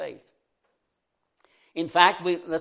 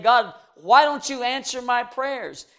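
higher, louder plaintive demand; complaining prophet; 7 semitones higher, 5 dB louder than usual. Retold or imitated. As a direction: plead like a frustrated prophet. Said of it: "He was complaining and saying".

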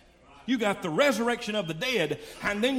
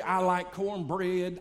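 usual speaking voice

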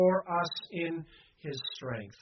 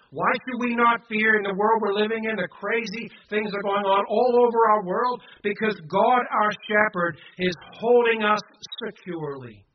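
loud, raised-pitch preaching; fervent preaching; 4 semitones higher, 7 dB louder than usual. Real, not acted. Preacher asks congregation why not fear, fervent and loud. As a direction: drive the question and answer home forcefully.

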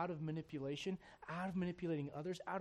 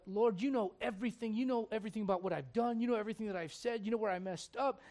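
higher, louder, rushed anguished plea; pleading Peter; 6 semitones higher, 6 dB louder than usual. Retold or imitated. Peter, asked a third time, answers Jesus in a high, rushed plea. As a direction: pour it out as Peter, desperate and exposed.

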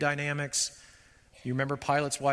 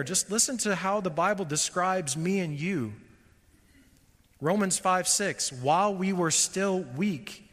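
higher, louder fervent preaching; preaching at full force; 5 semitones higher, 3 dB louder than usual. Acted. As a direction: press the congregation hard with the point. Preaching climax.

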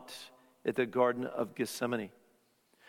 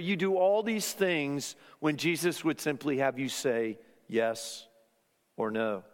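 normal talking voice

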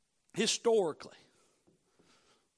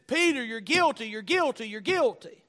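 loud, raised accusing refrain; condemning accusation; 4 semitones higher, 7 dB louder than usual. Retold or imitated. The crow convicting Peter of his denial, condemning accusation. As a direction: hammer out the repeated verdict. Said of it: "a crow of condemnation".

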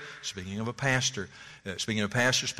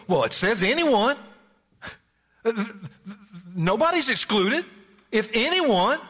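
higher-pitched, insistent stress on "anyone"; wooden literal reading; 8 semitones higher, 3 dB louder than usual. Acted. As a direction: play a naive literalist protesting, "anyone" pitched high. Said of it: "if you're reading this in a wooden way".

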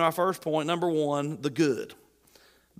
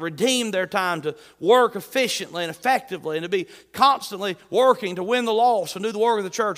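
loud, driving sermon cadence; pounding Sunday-night sermon; fiery preaching; 3 semitones higher, 5 dB louder than usual. Acted. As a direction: preach it with rising fire. Preacher builds to full force.

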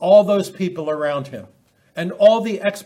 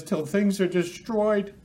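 voice of God; 5 semitones higher, slower than usual. Retold or imitated. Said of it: "God says".

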